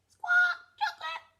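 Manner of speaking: very high, loud parrot squawk; parrot mimicry. A narrator's reading. Parrot copies Susie Sheep's order, high loud squawky mimicry. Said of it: "Polly Parrot copied".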